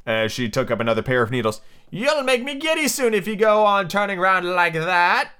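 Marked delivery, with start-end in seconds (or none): 1.9-5.3 high, slow, drawn-out sheep voice; bleating sheep. A narrator's reading. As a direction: bleat it slowly, pitched up. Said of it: "the sheep said".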